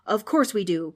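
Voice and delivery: loud, breathy protest; indignant outburst; cried out. Acted. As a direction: cry out in protest. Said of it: "cried the ghost".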